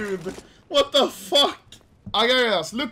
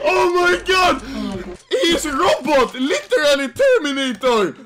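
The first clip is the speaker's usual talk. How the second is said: high, loud shouting; worked-up yell, pitch way up; hysterical amazement; 7 semitones higher, 6 dB louder than usual. Real, not acted. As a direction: shout in gleeful disbelief, close to laughing. Amazed by robotic-sounding teammate.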